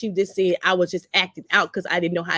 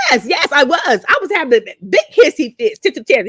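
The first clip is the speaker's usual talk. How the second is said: excited admission; 6 semitones higher, 5 dB louder than usual. Real, not acted. Admitting her tantrums, animated.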